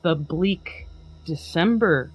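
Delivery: hammed-up storyteller swell, then sink; dramatic poem reading. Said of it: "a dramatic, if not comical, reading".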